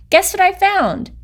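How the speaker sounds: high, bursting-with-news call; excited shout. A narrator's reading. Jessica calling to her friends with news, high and excited. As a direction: blurt out your big news. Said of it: "she shouted".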